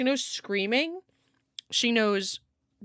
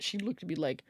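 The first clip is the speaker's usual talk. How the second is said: normal talking voice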